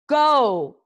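loud, high barked command; yell. A narrator's reading. Hiccup ordering his dragon into flight, yelled command. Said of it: "yelled Hiccup".

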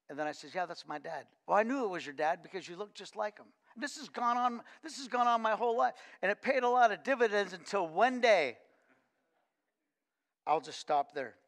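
usual speaking voice

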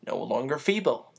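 plain reading voice

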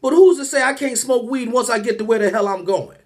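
ordinary talk, nothing marked

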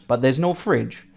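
ordinary delivery

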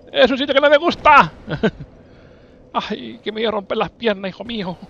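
high loud panicked cry; panicked yelling. Real, not acted. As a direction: shriek in panic mid-jump. Dreaded jump, yelling.

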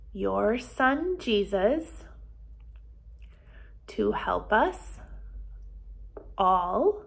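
slow, gentle prayer in short phrases; children's prayer. Acted. Teacher leads children in a repeat-after-me prayer, slow and soft. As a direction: lead the prayer phrase by phrase, unhurried. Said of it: "Why don't you repeat after me?".

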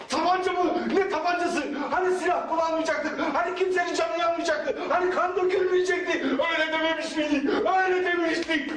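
high, rising panicky objection ending in a shout; alarmed protest. Acted. Nervous robber protests to his boss about the gun, panicky and high.

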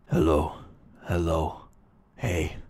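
very low, hushed put-on voice; fake voice. Shown as a demonstration. Prankster trying out a disguise for the call, fake deep voice. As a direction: disguise yourself with a deep, soft voice. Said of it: "a fake voice. I'm gonna be like".

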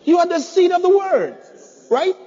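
high, forceful preaching cry; loud proclamation. Real, not acted. Preacher telling the congregation who they are, loud proclamation.

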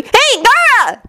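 very high-pitched greeting; excited. A narrator's reading. Naruto greets Gaara, high and excited. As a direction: call out eagerly. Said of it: "Naruto said excitedly".

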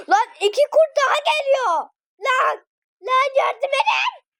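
high panicked calls for help, half laughing; being chased. Real, not acted. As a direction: yell for help as wolves close in. Wolves chase him, cries help.

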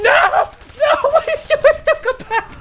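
high, loud laughing panic; laughing scream. Real, not acted. Gamer shrieking with laughter as his buddy runs off. Game character walks into danger.

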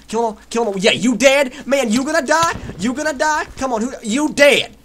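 high, loud trash talk; hyped-up gamer taunt; aggressive taunting. Real, not acted. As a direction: crow over each kill. Taunting victims while attacking.

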